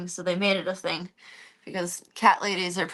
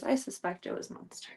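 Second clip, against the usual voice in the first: softer conspiratorial hush; quiet confiding tone; hushed aside; 3 semitones higher, 9 dB quieter than usual. Real, not acted. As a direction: murmur the suspicion quietly. Hushed suspicion.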